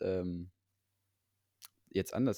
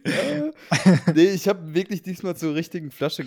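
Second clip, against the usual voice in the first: laughing; 8 semitones higher, 9 dB louder, slower than usual. Real, not acted. Laughing defence.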